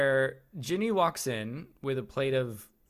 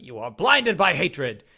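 loud, drawn-out theatrical rebuke; hammed-up outburst, slow and forceful; mock angry scolding; 9 dB louder than usual. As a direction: imitate a stern, angry telling-off. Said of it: "gets a little angry with him".

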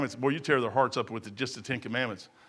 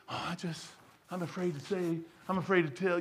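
mock timid mumble; 8 dB quieter than usual. Mocking timid Christians.

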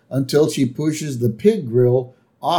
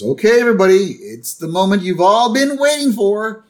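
higher announcer-like Homer; Homer announcing; 8 semitones higher than usual. Retold or imitated. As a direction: present the grand dish. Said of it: "he goes".

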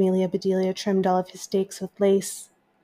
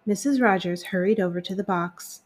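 ordinary delivery, nothing marked

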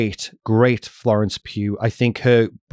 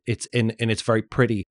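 normal talking voice

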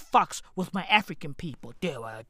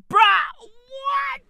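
much higher and louder, disbelieving shout; shocked; 7 dB louder than usual. Retold or imitated. Reacting to Doctor Umar's claim, shocked shout. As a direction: shout your disbelief at what you heard. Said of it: "I was like".

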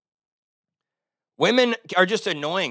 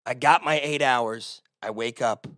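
ordinary talk, nothing marked

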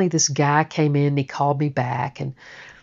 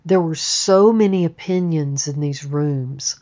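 ordinary talk, nothing marked

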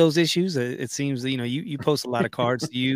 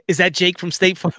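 State Farm ad quote; 6 semitones higher, 4 dB louder than usual. Retold or imitated. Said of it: "like homeboy from State Farm".

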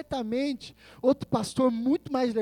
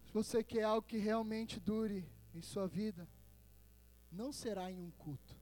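hushed exhortation; 14 dB quieter than usual. Real, not acted. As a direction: confide gently in the congregation. Drops to hushed, intimate exhortation. (exortação em voz baixa e pausada)

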